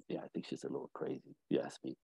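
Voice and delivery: low, fast hushed aside; voice dropped low. Retold or imitated. Husband gossiping on the phone, voice dropped to a hush. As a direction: drop the voice so she won't hear. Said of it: "she hears his voice drop completely".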